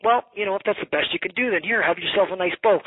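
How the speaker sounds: sarcastically magnanimous reply to a lowball buyer; mock generous seller. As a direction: hand it over with showy generosity. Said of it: "be like".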